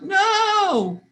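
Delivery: high, loud, drawn-out holler. A narrator's reading. Brandon stopping his sister grabbing his money, hollered. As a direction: holler it in alarm, stretching the word. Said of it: "Brandon hollered out".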